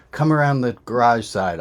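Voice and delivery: telling the courier where to go, calling out. Retold or imitated. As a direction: project it to someone outside. Calling through plastic to courier.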